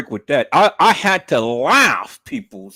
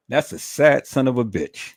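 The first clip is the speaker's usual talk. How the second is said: ordinary talk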